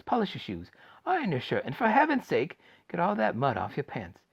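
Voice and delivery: put-on high, eager old man's voice; excited old grandfather. Grandpa Joe urging Charlie to get ready, high eager old voice. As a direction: bustle them along like a thrilled old man.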